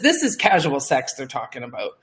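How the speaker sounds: ordinary talk, nothing marked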